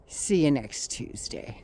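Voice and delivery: contemptuous aside. Real, not acted. Ranter signs off to the congresswoman, softly contemptuous. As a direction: let the insult fall away in disgust.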